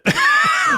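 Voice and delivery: a high burst of real laughter; shrieking laugh. Real, not acted. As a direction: crack up at the zinger, loud and high.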